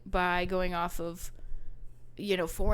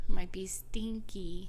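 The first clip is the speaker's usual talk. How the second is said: slow playful whisper; slower than usual. Real, not acted. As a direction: whisper it like a secret joke. Joking aside about unwashed poncho.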